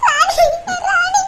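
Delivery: high tearful call to a friend; sobbing. Acted. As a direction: sob out the name helplessly. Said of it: "மூசு மூசுன்னு அழுகுறீங்க".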